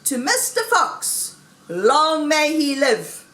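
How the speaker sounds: high, slow, ringing toast; shouted toast. A narrator's reading. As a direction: cheer him as a whole crowd would. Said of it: "they all shouted".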